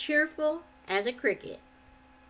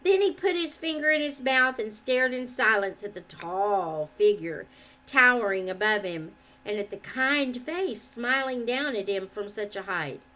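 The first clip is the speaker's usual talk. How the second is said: ordinary delivery, nothing marked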